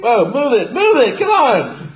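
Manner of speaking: shouting high, pushing through; high impatient shout. Pretend. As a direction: yell at him to hurry. Acting rushed commuter.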